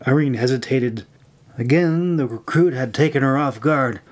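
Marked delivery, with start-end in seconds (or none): none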